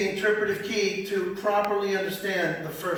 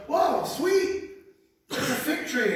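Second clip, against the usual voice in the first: delighted whoa; 6 semitones higher, slower than usual. Acted. Hungry Jesus spots fig tree.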